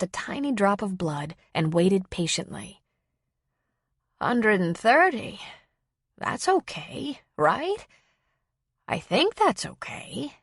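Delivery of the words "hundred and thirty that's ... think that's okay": put-on high, slow elderly lady seeking reassurance; slow, tentative old woman; unsure old woman. A narrator's reading. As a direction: read it out hesitantly in an old lady's higher voice. Old woman unsure of her reading.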